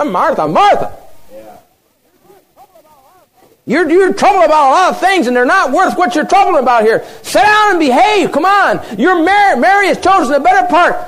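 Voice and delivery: high, forceful preacher's rebuke; loud scolding. Acted. Jesus telling busy Martha to sit down, loud scolding. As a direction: scold her at full voice.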